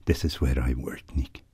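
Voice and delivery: hushed, gentle Irish priest voice; soft priestly tones. A narrator's reading. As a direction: speak to him like a kindly confessor. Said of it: "soft, gentle tones of a priest".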